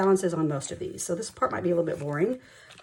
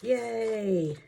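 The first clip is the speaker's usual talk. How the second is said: little cheer; 4 semitones higher than usual. Real, not acted. Cheering over counted cash.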